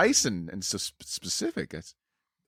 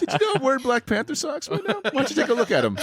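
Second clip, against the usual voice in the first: high silly voice; 10 semitones higher than usual. Acted.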